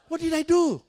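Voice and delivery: high, loud cry of innocence; hammed-up indignant outburst; exasperated protest. Acted protest to his accuser.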